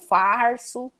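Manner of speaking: falso dito farso com R puxado; caipira R. Shown as a demonstration. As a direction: puxe o R em farso. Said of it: "Puxa muito R". (farso com R caipira retroflexo)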